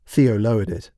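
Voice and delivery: usual speaking voice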